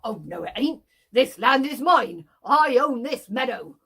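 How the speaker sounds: put-on loud childish back-and-forth; two farmers bickering over a meadow, cross; squabbling farmers. Two farmers quarrel over land.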